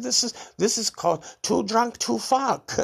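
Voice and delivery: high, slow put-on voice; slow and high, playing an eighty-five-year-old; mock old rocker. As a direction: ham up being an old punk. Imagined telling granddad at eighty-five.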